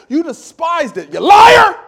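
high, loud furious rebuke; furious shout. Acted. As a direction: scream it at the liar. Pastor rebukes Esau.

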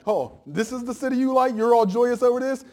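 lightly voiced God mocking Tyre. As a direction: taunt the city with a grin. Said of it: "God's like".

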